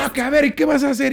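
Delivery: high-pitched taunt with a laugh; mock defiance. Acting out drunk friend's defiance. (reto burlón, agudo, entre risas)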